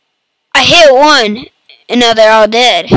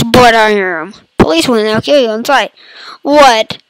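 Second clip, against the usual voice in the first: usual speaking voice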